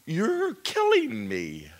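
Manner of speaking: a broken cry to God. Retold or imitated. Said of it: "and I started crying".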